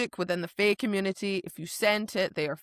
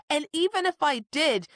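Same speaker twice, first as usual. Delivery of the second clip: acted indignant retort; 8 semitones higher than usual. Heroine berating Tamlin for selling them out, acted indignation. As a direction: spit the insults theatrically, pitched up.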